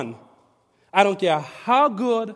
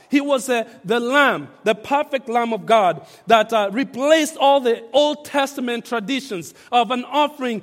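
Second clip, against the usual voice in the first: louder, rising preacher's fervour; preaching; 3 semitones higher, 5 dB louder than usual. Acted. Pastor preaching the Lamb of God to the church, fervent. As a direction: build it up like a sermon climax.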